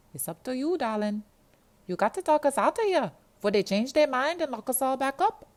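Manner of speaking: put-on a sassy Jamaican girl giving up on the phone; weary Jamaican girl. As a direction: sigh it out in a big, high Jamaican voice.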